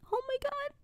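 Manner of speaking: very high, soft squeak of despair; exasperated disbelief. Retold or imitated. Customer asked the same order again, high soft exasperation. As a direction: let it out tiny and high, at wit's end.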